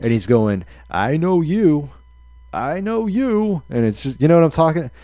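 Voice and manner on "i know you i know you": slow, creepy stalker singling someone out; hammed-up obsessed fan, slow and high; real creepy. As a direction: stretch each word, pitch climbing eerily. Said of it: "real creepy".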